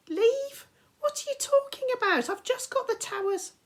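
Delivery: outraged at being pulled away; indignant. Acted. Annabeth annoyed, interrupted.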